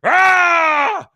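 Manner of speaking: high, loud hammed-up roar; mock berserk yell; mock rage scream.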